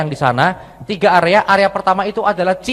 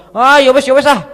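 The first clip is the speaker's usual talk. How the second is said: higher, louder mock sulk; sulky huff; 8 semitones higher, 6 dB louder than usual. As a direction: give up in a huff like an offended mom. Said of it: "Ngambek dia".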